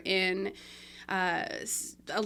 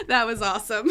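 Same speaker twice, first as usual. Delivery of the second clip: laughing; 9 semitones higher, 7 dB louder, faster than usual. Real, not acted.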